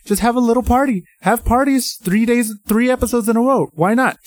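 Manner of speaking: high, pleading whine at the characters. Retold or imitated. Fan pleading with TV characters, high and whiny. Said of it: "I'm like".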